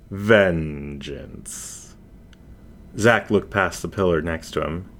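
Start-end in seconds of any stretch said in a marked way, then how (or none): 0.1-1.9 low, very slow, otherworldly menace; menacing shadow voice. Acted. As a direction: savour each word like a hunter cornering prey. Shadow voice answers.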